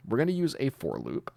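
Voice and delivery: normal talking voice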